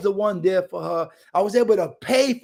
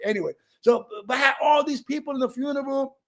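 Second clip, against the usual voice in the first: ordinary talk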